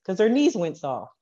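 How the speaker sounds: normal talking voice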